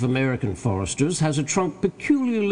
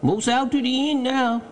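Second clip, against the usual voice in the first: high-pitched dialect call; Jupiter, obedient servant; 12 semitones higher than usual. A narrator's reading. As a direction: call down your progress from the limb. Jupiter reports progress.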